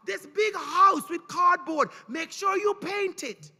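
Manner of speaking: high, loud, sing-song teacher giving homework; classroom teacher voice. Retold or imitated. Teacher dismissing his pupils with homework, high and loud. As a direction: call out instructions to the kids at the bell.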